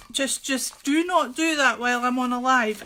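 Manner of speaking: "not" hit firm and loud; stressed not. Real, not acted. Wife telling husband off during the live, stressing not. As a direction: stamp on the word "not".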